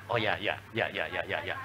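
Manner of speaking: soft, low, meek string of yeses. Retold or imitated. Wife meekly agreeing with her angry husband, soft and low. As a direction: mumble agreement like a submissive wife. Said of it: "Suami marah terus".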